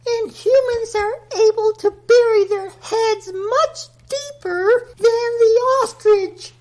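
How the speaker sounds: high, airy goddess voice pitched well up; a lofty earth goddess lecturing the host; Mother Nature voice. Acted.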